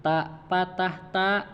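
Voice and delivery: slow, clear "Ta patah ta"; teacher modelling "Ta patah ta"; slow spelling drill. Shown as a demonstration. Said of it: "Saya dulu, Pak, ya".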